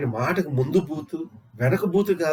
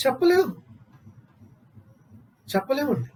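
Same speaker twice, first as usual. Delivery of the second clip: emphatic exclamation; 7 semitones higher than usual. Real, not acted. Rhetorical answer to own question.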